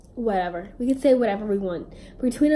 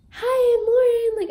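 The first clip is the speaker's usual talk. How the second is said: wished-for greeting; 13 semitones higher, 5 dB louder, slower than usual. Retold or imitated. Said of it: "I wasn't even like".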